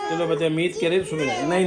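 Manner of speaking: normal talking voice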